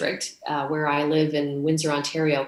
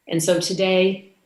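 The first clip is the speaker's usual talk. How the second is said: ordinary talk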